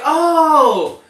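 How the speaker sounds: high, loud "Oh" of sudden understanding; mock realization. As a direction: act out the teacher finally getting it. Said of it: "She was like".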